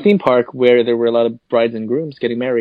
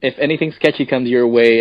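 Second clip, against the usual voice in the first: ordinary delivery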